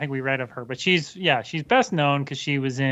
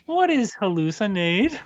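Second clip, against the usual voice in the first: put-on strange, halting foreign accent, pitched up; puzzled question in an odd foreign accent; Tommy Wiseau; 6 semitones higher than usual. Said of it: "like, Tommy Wiseau's voice".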